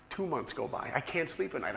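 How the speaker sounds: ordinary talk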